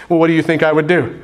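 ordinary talk

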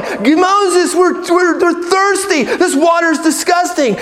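high-pitched mock whining complaint; grumbling. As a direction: gripe at Moses like a fed-up crowd. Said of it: "the people there are grumbling".

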